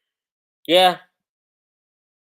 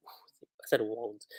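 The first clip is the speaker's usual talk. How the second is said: whispered aside, slow and breathy; 4 semitones lower, 8 dB quieter, much slower than usual. Real, not acted. Correcting a misread word in an unvoiced whisper.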